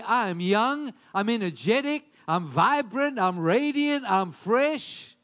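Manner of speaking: loud, punchy run of declarations; energetic affirmation. Acted. Preacher showing what to dare to say each morning, energetic affirmation. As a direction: proclaim each quality with force.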